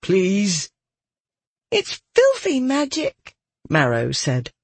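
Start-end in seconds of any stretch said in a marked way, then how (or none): 0.0-0.7 restrained pleading, slightly higher; forced calm. A narrator's reading. Captive asking the girl's help, forced calm. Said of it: "struggling to remain calm". 1.7-3.3 put-on much higher, childlike voice; small high voice, unwilling; reluctant girl. A narrator's reading. Captive girl refusing to touch magic, high and childlike.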